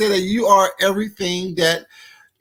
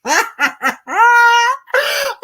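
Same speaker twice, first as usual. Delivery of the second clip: high cackle with a loud peak; helpless cackle; 5 dB louder than usual. Real, not acted. Reactor repeating the cooking insult, helpless cackle. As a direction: cackle in a rising burst.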